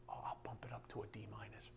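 quick, feeble mock whisper; weak teacher. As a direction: give in meekly under your breath, parodying a teacher. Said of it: "It's like a weak teacher".

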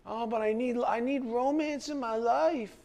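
high, pleading mock whine; whiny lovesick voice. Preacher mocking a lovesick person who craves romance.

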